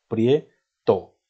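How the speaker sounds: "prieto" broken slowly into prie - to; dividido en sílabas. Shown as a demonstration. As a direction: pause between the two syllables of "prieto", prie, to. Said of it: "dos sílabas". ("prieto" separado despacio: prie - to)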